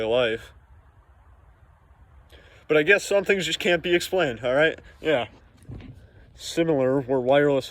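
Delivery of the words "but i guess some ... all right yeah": higher, louder sarcastic aside. Acted. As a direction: shrug it off mockingly. Sarcastic aside in news read.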